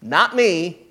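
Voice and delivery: a pointed, lightly voiced Jesus. Pastor voices Jesus pushing the task back to the disciples, pointed. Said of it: "You, you go feed them".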